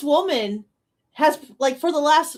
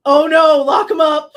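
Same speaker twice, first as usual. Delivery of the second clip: mock alarm; 3 semitones higher, 9 dB louder than usual. Mock outrage at the clip.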